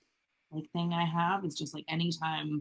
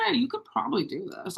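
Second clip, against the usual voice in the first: tempting inner voice; 6 semitones higher, faster than usual. Retold or imitated. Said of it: "this little voice that goes".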